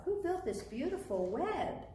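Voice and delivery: high, swooping storybook owl question. A narrator's reading. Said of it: "asked the owl".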